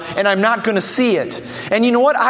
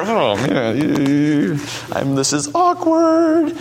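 awkward bystander; 5 semitones lower, slower than usual. Retold or imitated. Preacher imitating an embarrassed churchgoer, awkward. Embarrassed onlooker at loud prayer.

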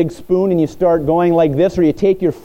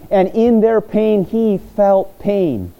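slow tender emphasis; 5 semitones higher, slower than usual. Acted.

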